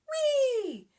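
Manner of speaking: high, loud whee down the slide; gleeful yell. A narrator's reading. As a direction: squeal with joy sliding down. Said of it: "Callie yells as she glides down".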